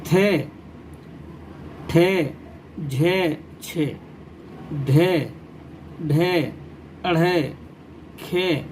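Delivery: each aspirated letter said alone, slowly and clearly; reading letters aloud. Shown as a demonstration. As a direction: recite the letters one by one for children. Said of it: "پڑھ کر پیش کرتا ہوں". (بچوں کے لیے حروف کی صاف ادائیگی)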